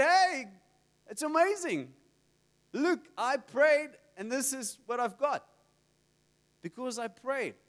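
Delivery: acted-out boy's triumph; excited boy. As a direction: burst in with amazed good news. Boy reports winning to his dad.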